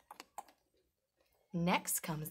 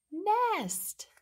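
excited reveal; 13 semitones higher, 6 dB louder than usual. Acted. High, loud reveal of the nest.

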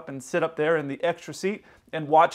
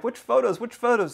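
higher-pitched quoted question; imitating captain; 6 semitones higher than usual. Captain asking for help choosing pictures, imitated in a higher voice. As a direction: repeat it in a raised voice, imitating him.

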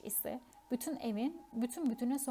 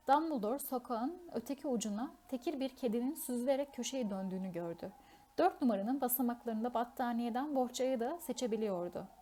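ordinary delivery

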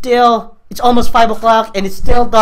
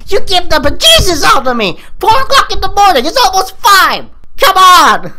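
loud, rattled, higher; scared shouting; 7 semitones higher, 4 dB louder than usual. Real, not acted. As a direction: yell out in fright.

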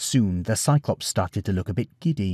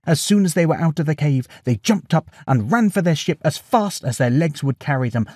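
plain reading voice

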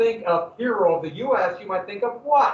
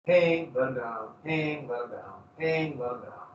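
ominous fading repeat; 6 semitones lower, 6 dB quieter, slower than usual. Acted. Grim repeated torture description.

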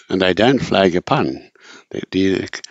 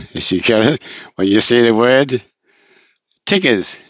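normal talking voice

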